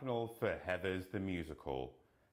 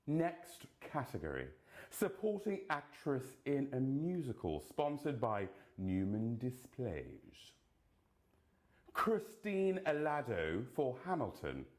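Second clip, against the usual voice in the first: ordinary talk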